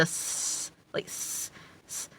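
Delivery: a drawn-out, hissy "s-"; lisped S. Shown as a demonstration. Showing viewers the sound heard when she talks, lisped S. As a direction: isolate "s-" as a sustained sibilant. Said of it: "like, a lisp or".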